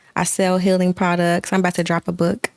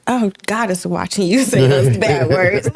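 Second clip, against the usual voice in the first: mock child scolding. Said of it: "she be like".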